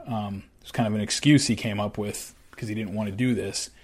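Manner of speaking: ordinary talk, nothing marked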